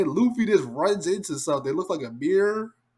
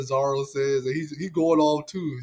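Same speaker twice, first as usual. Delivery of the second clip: usual speaking voice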